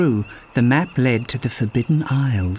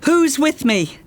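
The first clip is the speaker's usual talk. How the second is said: rally the room with it; calling out; 12 semitones higher than usual. Acted. Calling out to tavern.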